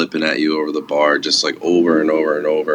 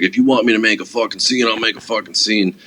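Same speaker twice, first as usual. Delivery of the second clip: put-on higher, raised-voice drunk threat; mock angry drunk; 5 semitones higher than usual. Drunk patron snapping back at the bouncer, mock angry. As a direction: imitate a belligerent drunk pushing back. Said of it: "starts, like, yelling".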